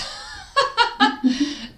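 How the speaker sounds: high-pitched burst of laughter; real laugh at her own impression. Real, not acted. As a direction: crack up laughing.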